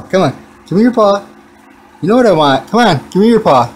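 ordinary talk, nothing marked

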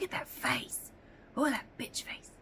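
whispered aside. Real, not acted. Whispering about the cat's face. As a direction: breathe it under the voice.

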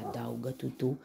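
ordinary delivery, nothing marked

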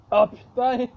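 pained shout. Real, not acted. Burning mouth outburst.

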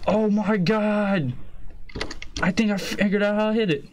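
high, thrilled shouting; buzzing with excitement; excited. Real, not acted. Almost beats the level.

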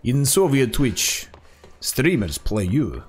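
put-on low, slow Soviet-style Russian accent; lightly hammed Russian accent joke; mock Russian accent. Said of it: "Russian or German accent".

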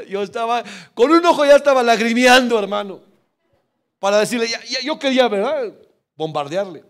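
loud, high excited storytelling; excited retelling. Real, not acted. Pastor recounting the gift, excited and loud. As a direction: swept up telling the good news. Tearful excitement retold loudly.

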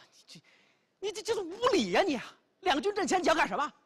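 high, loud outraged protest; indignant. Acted. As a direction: bristle at the insult, stammering. Lord indignant as clothes stripped.

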